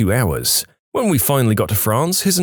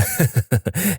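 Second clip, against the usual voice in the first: higher-pitched acted laugh; mock laugh; 5 semitones higher than usual. Pilots jeering over the radio, mock laugh. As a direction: put on a heckler's laugh.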